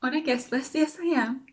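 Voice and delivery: high-pitched, self-satisfied hum and agreement; playing along. Guest nodding away at the staring queue, playing along. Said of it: "I'm just nodding away like".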